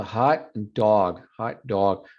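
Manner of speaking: "dog" stretched with a rounded "aw", slow; exaggerated dog. Shown as a demonstration. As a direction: lean hard on the vowel of "dog". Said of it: "I kind of exaggerate dog".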